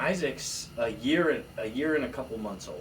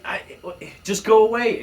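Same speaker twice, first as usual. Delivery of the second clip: higher, louder shout to leave; shouted go away; 9 semitones higher, 9 dB louder than usual. Retold or imitated. Boy shooing the tattling neighbor, loud shout. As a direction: yell at him to get lost.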